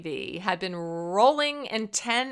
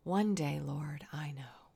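much softer, hushed trusting whisper; whispered prayer; 4 semitones lower, 8 dB quieter than usual. Acted. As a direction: breathe the words to God, barely voiced. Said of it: "she prayed yet again".

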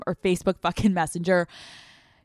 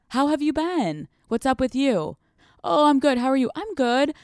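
mock small talk; 6 semitones higher than usual. Shown as a demonstration. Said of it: "You're pretty deep into the night".

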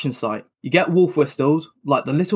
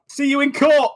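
tearful shout; 12 semitones higher than usual. Acted. The victim yells at the builders, mock tearful shout. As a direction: shout it through fake tears.

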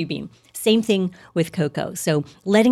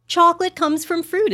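much higher, loud, thrilled announcement; excited; 12 semitones higher, 4 dB louder than usual. Real, not acted. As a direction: announce it like great news.

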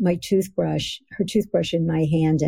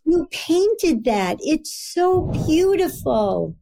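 much higher, slow gushing mother; a doting mom overpraising a painting; gushing mom praise; 10 semitones higher than usual. Retold or imitated. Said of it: "that's Mommy just telling me".

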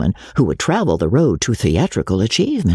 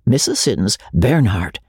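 ordinary delivery

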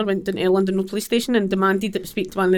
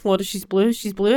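ordinary talk, nothing marked

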